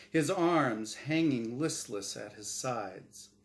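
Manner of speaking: steady storyteller reading aloud; story narration. A narrator's reading. Author reading his own story aloud, storyteller voice. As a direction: read the tale to your listeners.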